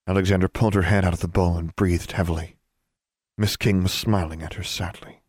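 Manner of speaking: usual speaking voice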